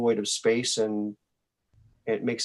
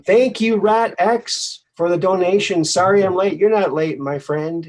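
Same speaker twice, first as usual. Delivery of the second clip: high, loud, beaming thank-you; excited thanks; 8 semitones higher, 8 dB louder than usual. Real, not acted. Host thanking a donor on stream, excited. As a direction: welcome them in with a big lift.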